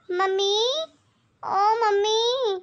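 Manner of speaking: slow drawn-out call for mom. Acted. Daughter arrives and calls out for her mother, drawn out.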